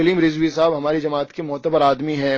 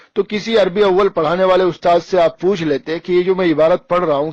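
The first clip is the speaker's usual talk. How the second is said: normal talking voice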